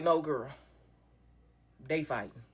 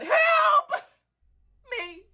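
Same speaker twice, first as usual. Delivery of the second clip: high, loud cry for help; calling for help; 4 dB louder than usual. Retold or imitated. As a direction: call out to the car for help. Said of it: "And I said".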